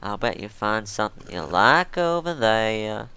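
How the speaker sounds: Southern hustler selling furniture info; deep southern accent. Acted. Tipster pitches cheap furniture info to chef, Southern accent. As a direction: sell the tip in a thick Southern accent. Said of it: "denizens of Los Angeles have a deep southern accent".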